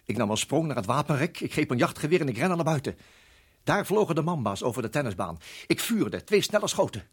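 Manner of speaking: loud and rising, urgent; breathless action. Acted. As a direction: race through the shots. Hij grijpt geweer en schiet. (gejaagd en luid)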